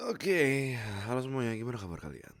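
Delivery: bright, loud hello to the viewers; lively greeting. Real, not acted. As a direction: greet everyone with energy. Pembukaan vlog menyapa penonton. (sapaan riang ke penonton)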